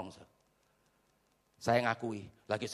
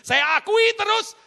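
high, loud, slow proclamation; emphatic shouted affirmation; preaching outburst; 12 dB louder, slower than usual. Real, not acted. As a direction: burst out at full voice, stretching it.

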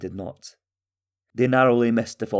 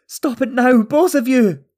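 high, loud desperate scream; 8 dB louder than usual. A narrator's reading. Mother breaking up father and son's fight, screamed. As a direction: scream at them to stop. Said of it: "Talgo's mother screamed".

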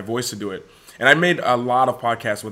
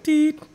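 machine beep. Shown as a demonstration. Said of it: "looks like an E K G machine".

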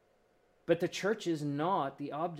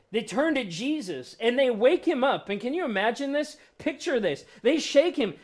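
ordinary delivery

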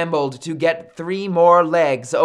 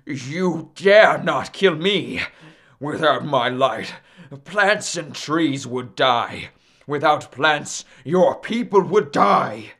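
blustering. Acted. Cornered Sun warns Maui not to kill him, blustering. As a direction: threaten pompously in a put-on voice. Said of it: "He began to bluster".

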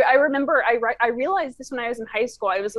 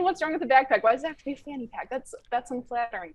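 ordinary talk, nothing marked

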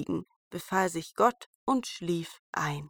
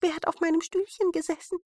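hohe, schnelle Zwergenstimme; erster Zwerg; 9 semitones higher, faster than usual. A narrator's reading. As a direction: quiekend hoch und eilig fragen. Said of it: "Der erste sprach". (hohe, flinke Zwergenstimme)

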